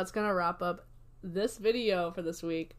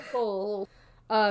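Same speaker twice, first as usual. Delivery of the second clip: ordinary delivery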